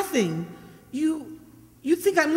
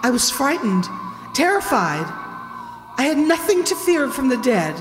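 lower, measured storytelling; suspense narration; 6 semitones lower than usual. A narrator's reading. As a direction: tell the tale to build dread. Narrates eerie cemetery walk.

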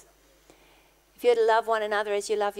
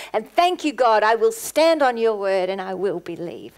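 full-force preaching; 10 dB louder than usual. Real, not acted. Preacher thanking God before the congregation, full-force preaching. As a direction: proclaim it boldly to God.

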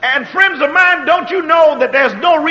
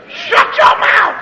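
emphatic command; 9 semitones higher, slower than usual. Real, not acted. Preacher's refrain.

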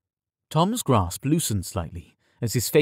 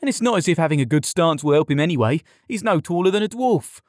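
quick, higher-pitched jeer; taunting jibe; 6 semitones higher, 3 dB louder, faster than usual. A narrator's reading. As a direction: rattle off the jibe to needle him. Tom keeps taunting Yared.

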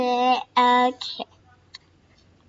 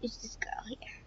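hushed, softer question; curious whisper; 3 semitones lower, 21 dB quieter, faster than usual. Real, not acted. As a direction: whisper as if not to be heard. Curious whisper at new character.

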